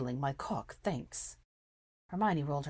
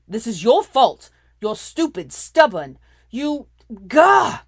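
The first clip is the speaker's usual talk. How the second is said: furious yelling; 6 semitones higher, 16 dB louder, slower than usual. A narrator's reading. Draco yelling blame.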